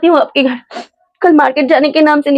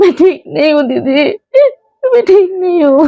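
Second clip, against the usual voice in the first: crying as she speaks; tearful; 3 semitones higher than usual. Acted. Meera tells her elder friend she is not okay, tearful. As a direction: break into sobs while confiding. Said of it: "तू रो क्यों रही है?".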